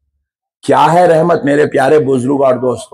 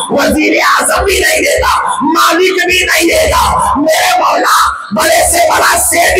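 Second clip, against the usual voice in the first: high, strained crying-out plea, building in pitch; full-force impassioned preaching; 9 semitones higher than usual. Real, not acted. Preacher pleading with God before the crowd, full-force impassioned preaching. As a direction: cry out to God in shouted, rhythmic phrases.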